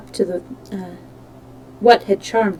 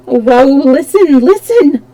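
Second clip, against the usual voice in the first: pleading; 4 semitones higher, 7 dB louder than usual. Acted. Christine desperately pleading.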